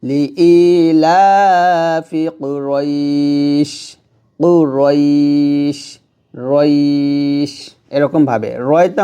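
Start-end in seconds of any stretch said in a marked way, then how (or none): 0.1-7.5 কুরাইশ ended on a silent শ, long drawn ই before it; stopping on shin. Shown as a demonstration. Teacher showing students the stop on Quraysh, slow recitation. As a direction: stretch রইশ and close on a sakin শ. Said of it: "শিন ... থামলে হবে".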